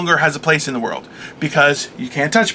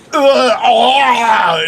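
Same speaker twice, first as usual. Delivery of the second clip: disgusted groan; 5 semitones higher, 3 dB louder than usual. Retold or imitated. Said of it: "And I'm like".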